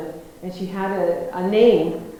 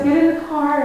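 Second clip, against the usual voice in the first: eager command; 6 semitones higher, 7 dB louder than usual. Retold or imitated. Farmer orders her into car.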